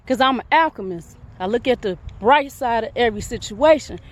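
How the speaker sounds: normal talking voice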